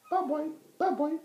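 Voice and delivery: very high whistled call; bird call. A narrator's reading. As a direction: call like a bobwhite.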